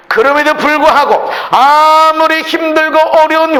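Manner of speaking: full-force, high-pitched preaching. Acted. Preacher retelling neighbors' blame of the blind man, impassioned preaching. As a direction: drive the message home loudly, hardly letting up.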